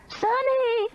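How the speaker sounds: very high desperate scream; screamed name. A narrator's reading. Meemaw screams for the missing woman. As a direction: cry out the name in fear. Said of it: "Meemaw screamed".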